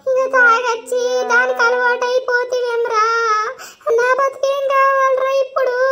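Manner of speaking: tearful high-pitched lament; crying. Acted. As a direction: cry out the betrayal, voice climbing. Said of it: "ఏడవకే నువ్వు".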